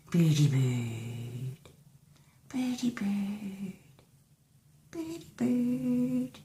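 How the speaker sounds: sing-song call to the cat; slow high pet talk. Real, not acted.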